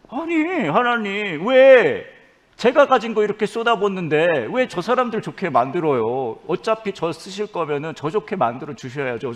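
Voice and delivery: high pleading protest to God; whiny complaint. Acted. Preacher asking God why others get blessed, whiny protest. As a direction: open on a high wail, then argue. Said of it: "라는 고백이 저한테 있을 때니까요".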